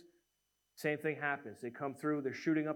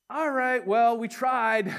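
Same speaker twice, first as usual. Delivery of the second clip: mock resignation; 6 semitones higher, 6 dB louder, slower than usual. Mock giving-up quote.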